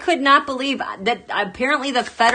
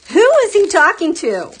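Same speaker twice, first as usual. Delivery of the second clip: incredulous outcry; 8 semitones higher, 7 dB louder than usual. Real, not acted. Repeated refrain louder.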